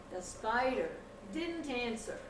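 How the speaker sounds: ordinary delivery, nothing marked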